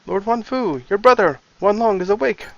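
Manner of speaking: high, loud urgent news; excited announcement. Acted. Excited messenger voiced high.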